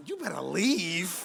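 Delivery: slow, menacing little warning; hammed-up dislike, slow and controlled; mock hostility. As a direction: warn off the intruder, drawing it out. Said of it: "but you hate them already".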